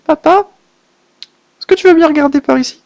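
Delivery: put-on high childlike girl's voice; little girl voice. The little girl confides fears about papa's new partner, childlike voice. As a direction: speak as a small timid girl.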